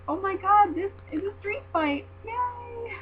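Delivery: high, giddy cheer, imitating own reaction; re-enacted high-pitched excitement; excited fangirl. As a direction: squeal it like a thrilled fan, ending on a high drawn-out yay. Quotes own excited reaction at match.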